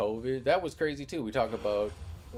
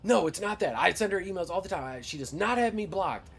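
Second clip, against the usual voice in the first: mock indignant customer; 8 semitones higher, 5 dB louder than usual. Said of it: "then she's like".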